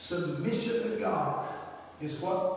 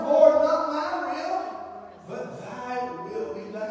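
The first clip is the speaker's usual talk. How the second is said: high, loud and slow, crying out to the Father; fervent cried prayer; 9 semitones higher, 6 dB louder, slower than usual. Acted. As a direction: cry the prayer out with full force. Preacher repeats Jesus' prayer loudly.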